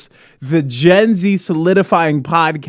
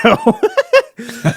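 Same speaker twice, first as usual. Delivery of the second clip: high laugh. Real, not acted. Laughing at one video.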